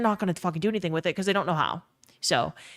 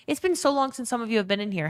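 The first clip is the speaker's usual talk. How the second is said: normal talking voice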